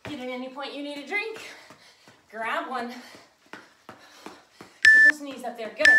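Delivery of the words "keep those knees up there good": loud, slow coach shout; barking gym coach. Real, not acted. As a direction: bark it out over the music. Coach pushing the class.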